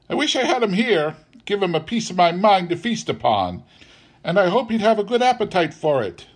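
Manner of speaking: high, quick scolding; indignant wife. A narrator's reading. Wife wants to give Scrooge a piece of her mind, indignant. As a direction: rail against the absent employer.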